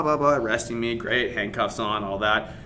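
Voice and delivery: ordinary talk, nothing marked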